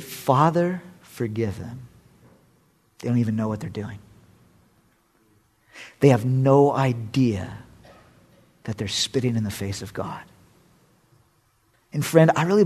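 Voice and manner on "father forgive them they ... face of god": tender plea. Retold or imitated. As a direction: breathe it gently like a dying prayer. Quoting Jesus on the cross.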